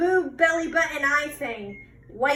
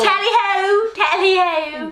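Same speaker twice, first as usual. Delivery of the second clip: mock British "tally ho" cry; British accent; 5 semitones higher, 6 dB louder, slower than usual. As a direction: sing out "tally ho" like a Brit. Joking British phrases.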